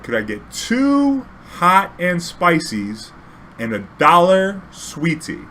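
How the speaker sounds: over-enunciating for a foreigner. Retold or imitated. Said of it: "talk a little louder or a little slower".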